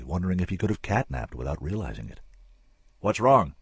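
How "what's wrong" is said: a loud, pressing question; demanding. A narrator's reading. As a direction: demand an answer right now. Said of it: "Dimsdale demanded".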